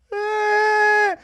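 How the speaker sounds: long, loud, very high squeal; high-pitched shriek. Real, not acted. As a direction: shriek in gleeful disbelief. Hype outburst over fighter crying.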